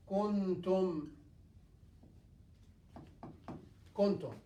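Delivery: Kuntum with the nun half hidden; ikhfa demo. Shown as a demonstration. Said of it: "This Nun Sakina and this is Ta".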